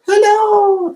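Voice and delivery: a squeaky, sky-high hello; high greeting. Real, not acted. Host greets the producer on camera, high-pitched hello. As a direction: greet him with a jump way up in pitch.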